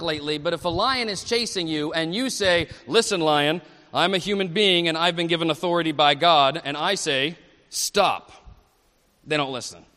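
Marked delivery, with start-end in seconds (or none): 2.9-8.3 low, loud voice of pretend authority; mock commanding. Human orders a chasing lion to stop, mock commanding. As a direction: order it to halt in a lowered, forceful voice.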